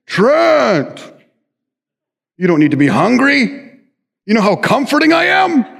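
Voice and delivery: high, coaxing fridge voice with a laugh; tempting fridge voice. Retold or imitated. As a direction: lure him like a sweet tempter. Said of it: "my refrigerator calls to me".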